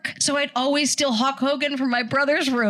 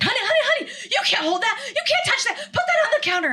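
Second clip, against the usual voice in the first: high, panicky cashier scolding; high, laughing mock panic; frantic cashier; 13 semitones higher, faster than usual. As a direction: mimic the shop lady losing it. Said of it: "the lady lost her mind".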